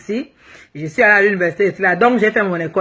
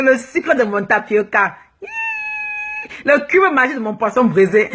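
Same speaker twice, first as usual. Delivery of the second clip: mock love declaration. Declaring her love to her husband Charles, laughing and hammed-up. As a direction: gush over him with playful exaggeration.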